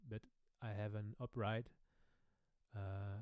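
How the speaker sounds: ordinary talk, nothing marked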